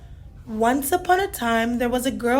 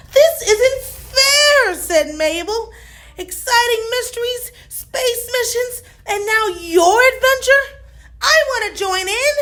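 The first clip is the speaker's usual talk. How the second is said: whiny complaint; 10 semitones higher than usual. A narrator's reading.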